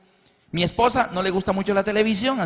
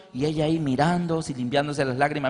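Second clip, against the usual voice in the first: usual speaking voice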